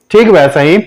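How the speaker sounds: usual speaking voice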